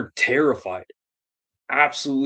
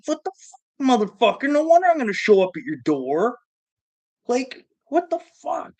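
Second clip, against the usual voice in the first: mock outrage; 10 semitones higher than usual. Angry quote at debtor.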